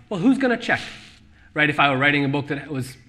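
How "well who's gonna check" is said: higher mock-careless question; mocking shrug. Author voicing careless writers about Yiddish, mocking shrug. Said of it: "there is this sense of".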